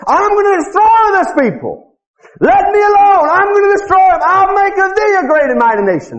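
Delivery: high, booming shout of divine wrath; angry God. Acted. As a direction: thunder it out as God passing judgment. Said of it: "he's mad. He's angry".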